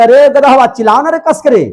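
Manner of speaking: high, loud mock command; scolding order. Brain orders the eyes to scream, loud scolding. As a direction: play an exasperated boss yelling orders. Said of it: "ब्रेनमा आदेश दिया आँखिया को".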